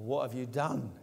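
"done" stressed with a falling end. Shown as a demonstration. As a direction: land on the word "done" in "What have you done?". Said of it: "put the emphasis wherever you like".

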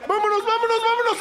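high, loud rallying shout; urgent cheering. Real, not acted. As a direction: yell them up the field. Urging a late counterattack. (grito agudo de arenga)

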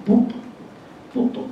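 「ポッと」の最後のトを母音つきではっきり言う; カタカナ式に「ポット」と読む; 日本人読み. Shown as a demonstration. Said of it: "私日本人ですから". (日本語式の「ポット」)

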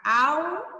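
"au" with the L split off, sounding like a U, no LH; separado sem o dígrafo. Shown as a demonstration. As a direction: say "au", stretched slowly, without the LH sound. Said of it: "se a gente for separar o L do H". ("au" pronunciado devagar, com L solto em som de U)

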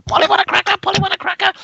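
high squawky parrot call. Acted. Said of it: "imitating different birds".

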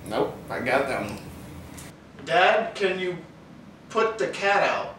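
ordinary talk, nothing marked

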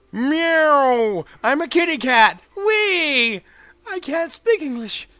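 put-on high squeaky cat-girl voice; silly kitty voice. Goofing as a kitty cat while playing. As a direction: play a giddy kitty cat.

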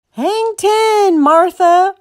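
high, drawn-out holler; loud, high surfer's yell; shouted. A narrator's reading. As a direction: call it out across the waves. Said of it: "Rob shouted".

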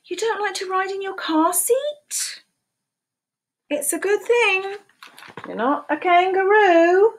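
lively picture-book read-aloud, questions pitched high; animated storytime voice with playful questions; reading to children. Acted. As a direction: read it like storytime for little ones.

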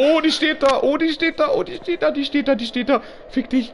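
high, loud frightened repeating; blurting in fright at the figure; real jumpscare panic; panicked. Real, not acted. Panicked repeat on seeing enemy.

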